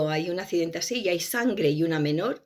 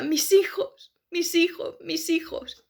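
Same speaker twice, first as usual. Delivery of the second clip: pleading cry; 9 semitones higher, slower than usual. Retold or imitated. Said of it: "decía".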